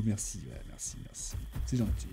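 low, soft thanks to oneself; quiet thanks. Real, not acted. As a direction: drop right down, almost inaudible. Mumbled thanks for compliment.

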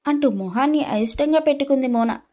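ordinary delivery, nothing marked

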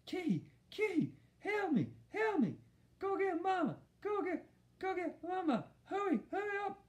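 very high muffled pleading; mouth full. A narrator's reading. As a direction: plead urgently with lips stuck to something. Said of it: "like he had something in his mouth".

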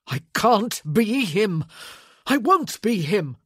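loud, high, emphatic outburst; anguished yelling. A narrator's reading. Man shaking the woman as he refuses, yelling. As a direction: cry it out, hammering each refusal. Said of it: "he yelled".

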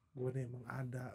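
low, soft spirit voice speaking through him; possessed voice. Retold or imitated. Possessed comedian speaks in seance.

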